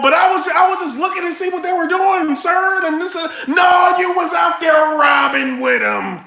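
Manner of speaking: Samantha pleading. Retold or imitated. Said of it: "Samantha on the phone".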